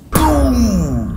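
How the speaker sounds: usual speaking voice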